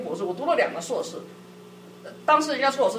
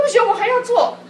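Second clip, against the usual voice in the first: much higher and louder, insisting; stubborn refusal; 11 semitones higher, 7 dB louder than usual. Retold or imitated. Husband insisting on finishing his paper, firm refusal. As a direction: refuse firmly, still keen to work.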